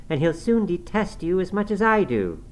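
high, emphatic taunt; spiteful defiance. A narrator's reading. Girl defies her furious father-in-law.

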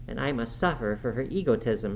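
ordinary delivery, nothing marked

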